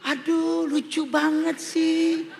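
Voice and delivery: high, drawn-out cooing; hammed-up squeal of adoration; gushing woman. Woman seeing a puppy, high mock gushing.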